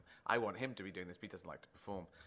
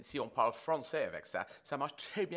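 French in a posh English accent; 3 semitones higher than usual. Shown as a demonstration. Guest showing French spoken in a posh English accent. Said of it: "take a very posh English accent".

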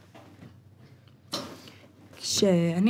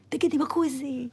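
hushed prompt; 4 semitones higher than usual. Retold or imitated. Recruits whispering in formation.

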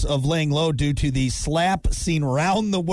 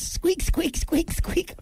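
high squeaky mouse voice; mock mouse squeaking, much higher; mouse squeaks; 13 semitones higher, much slower than usual. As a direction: squeak like a tiny mouse to lure the cat. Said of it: "I'm a little mouse".

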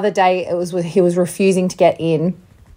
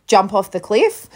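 usual speaking voice

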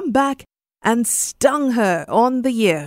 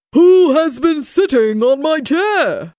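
loud, raised, indignant bear roar; great big voice; 7 semitones higher, 5 dB louder than usual. A narrator's reading. Papa Bear finds his chair sat on, great big voice. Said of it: "he cried out in his great big voice".

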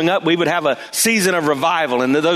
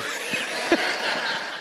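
soft breathy laugh; 9 dB quieter than usual. Real, not acted. After punchline about the radio.

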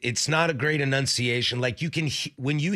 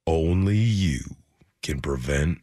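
deep, low gravel-free drawl sinking lower; Sam Elliott impression; 11 semitones lower, much slower than usual. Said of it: "I do a decent Sam Elliott".

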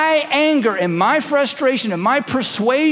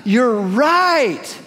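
gasp, then a drawn-out mock concession; mock surrender; 3 semitones higher than usual. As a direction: act out a dramatic revelation, hammed-up. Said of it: "throw up your hands and say".

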